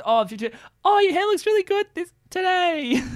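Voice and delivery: very high girly mimicry; imitated girl. Mocking the actress's botched compliment, high-pitched imitation. Imitating the girl's flubbed line.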